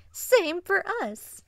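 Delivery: high, loud, slow-stretched squeal; excited vixen. Acted. Vixen bouncing on her hind legs, excited. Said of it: "the excited Arctic vixen".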